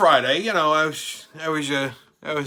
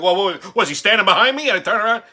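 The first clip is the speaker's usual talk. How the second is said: sudden alarmed whoa, higher and louder; startled; 6 semitones higher, 8 dB louder, faster than usual. Retold or imitated. Told to turn around, he blurts it out startled. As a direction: caught off guard, blurt it out. Realizes Trump stands behind him.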